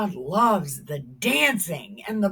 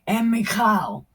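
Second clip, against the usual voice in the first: normal talking voice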